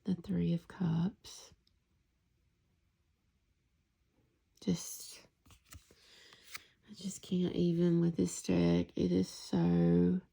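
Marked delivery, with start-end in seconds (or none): 0.0-8.9 whisper. Real, not acted. Whispering through card reveals.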